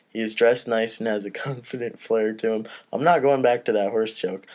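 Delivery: ordinary delivery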